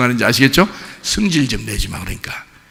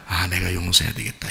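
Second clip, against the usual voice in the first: low, hushed note to self; soft inner voice, dropping right down; reflective self-talk; 7 semitones lower, 11 dB quieter than usual. Acted. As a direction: quietly make up your mind to forgive. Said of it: "이런 마음을 가지라".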